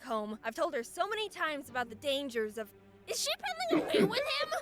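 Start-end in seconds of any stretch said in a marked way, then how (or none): 3.1-4.5 shrill, loud alarmed gasp; shocked realization. Pretend. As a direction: catch her in horror. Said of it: "point of view of this lady".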